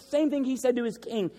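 usual speaking voice

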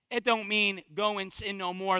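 ordinary talk, nothing marked

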